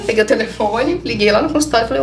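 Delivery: ordinary delivery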